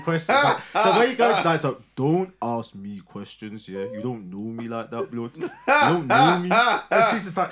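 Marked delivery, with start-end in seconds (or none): 2.0-6.5 slow, cocky Brummie street talk; road Birmingham accent. Shown as a demonstration. Answering his partner's normal questions in road Birmingham. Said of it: "Let's hear your Birmingham accent".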